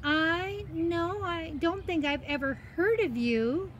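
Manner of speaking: put-on high, hesitant girl's voice; Alice. Girl answers cat after thinking, hesitant. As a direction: draw out a high, thoughtful answer. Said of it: "Alice thought for a minute".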